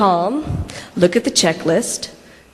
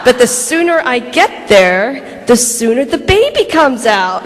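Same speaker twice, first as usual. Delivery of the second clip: mock pleading, higher and drawn out; insistent pleading; 4 semitones higher, 3 dB louder than usual. Labouring wife bargaining with husband for the hospital, pleading. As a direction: stretch it out, arguing your case.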